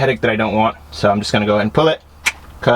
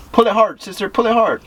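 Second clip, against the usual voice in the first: urging; 10 semitones higher than usual. Real, not acted. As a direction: egg her on to pull harder. Urging sister to pull hard.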